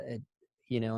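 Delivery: usual speaking voice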